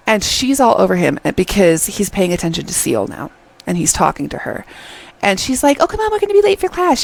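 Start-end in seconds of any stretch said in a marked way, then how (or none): 5.8-7.0 high, fast imitated pleading; mock whining urging. Clingy girl pulling him to class, imitated high whine. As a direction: hurry him along in a much higher voice.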